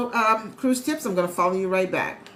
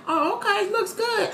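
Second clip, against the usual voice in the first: much higher, light approving quote; casual acted approval; 11 semitones higher than usual. Merchant looking over goods on sale, casually approving. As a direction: say it high, as if easily pleased. Acting out merchant viewing price.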